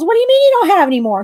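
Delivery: high, loud, fast protest; indignant disbelief. Retold or imitated. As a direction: act out outraged disbelief in a rush.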